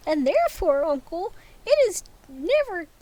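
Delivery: high, loud insistent nephew. Acted. Nephew insisting to uncle, high and forceful.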